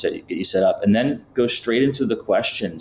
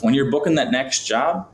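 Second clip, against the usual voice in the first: normal talking voice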